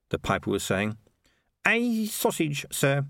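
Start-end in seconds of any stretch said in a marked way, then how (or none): none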